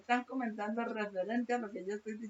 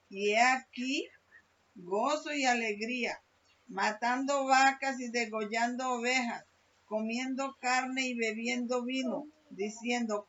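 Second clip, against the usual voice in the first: preaching; 5 dB louder than usual. Acted. Preacher reading scripture aloud.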